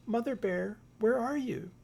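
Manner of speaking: high, loud call across the house. Acted. Little Bear calling for his missing mother, high and loud.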